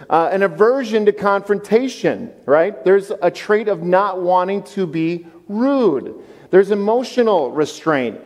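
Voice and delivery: ordinary talk, nothing marked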